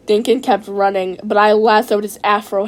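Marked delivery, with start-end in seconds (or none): none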